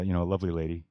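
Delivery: usual speaking voice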